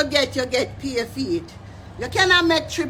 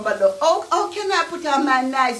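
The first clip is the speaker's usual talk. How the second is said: ordinary delivery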